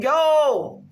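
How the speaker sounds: ordinary delivery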